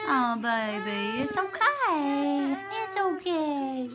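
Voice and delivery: slow, high, sing-song comforting. Real, not acted. Mother breaks off to comfort her baby, soothing.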